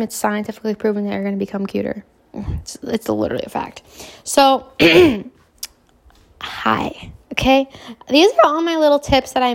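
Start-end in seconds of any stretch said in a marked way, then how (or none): 2.3-3.8 whispered aside, like a secret. Real, not acted. 6.4-7.1 a breathy whispered hi after clearing throat. Acted.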